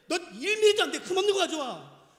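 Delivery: loud, high, emphatic order; forceful mock scolding; stern acted demand. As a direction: play an uncompromising God laying down the rule. Acts God strictly demanding flawless offering.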